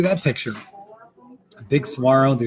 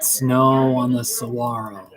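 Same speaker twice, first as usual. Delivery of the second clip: ordinary delivery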